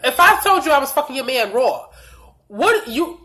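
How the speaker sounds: usual speaking voice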